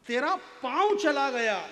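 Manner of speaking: loud, high declamation of a verse line; emphatic verse recital. Acted. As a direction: punch पाँव high and loud as the poem's climax. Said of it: "ये मेरी कीमती line आपके चरणों में". (ज़ोरदार, ऊँचे सुर में कविता पाठ)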